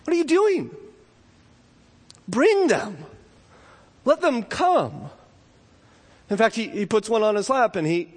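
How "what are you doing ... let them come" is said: much higher, slow incredulous question; lightly voiced Jesus, amazed then welcoming; Jesus rebuking. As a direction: gently scold the disciples, astonished. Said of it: "And Jesus looks at them and says".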